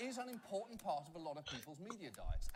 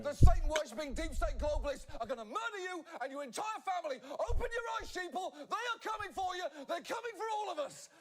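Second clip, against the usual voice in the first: high, loud paranoid ranting; doom-preach at the viewers; hammed-up Alex Jones panic rant; Jones parody; 11 semitones higher, 9 dB louder than usual. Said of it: "hard turn to make".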